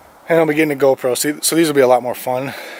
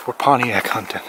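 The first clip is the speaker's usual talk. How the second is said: hunter's whisper; 10 dB quieter than usual. Pretend. Hushed stalking of a car, hunter style.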